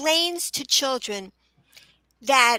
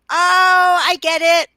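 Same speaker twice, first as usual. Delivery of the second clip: loud, higher "aha" moment; acted sudden realisation; 5 semitones higher, 10 dB louder, slower than usual. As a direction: burst out as if it just clicked.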